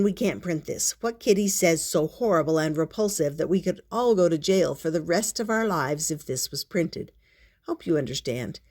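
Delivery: usual speaking voice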